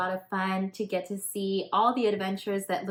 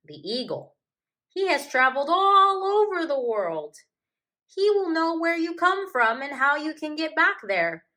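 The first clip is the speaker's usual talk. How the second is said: loud, higher, eager hippo voice; helpful hippo; 7 semitones higher, 7 dB louder than usual. Acted. Hippo tells the cub how to find the eagle, eager and loud. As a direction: explain the plan like an eager big friend.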